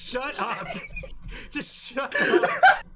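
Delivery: shrieking through laughter, very high; real high-pitched laughing fit through the words; laughing protest. Real, not acted. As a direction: tell them off while cracking up. Laughing at co-host's teasing.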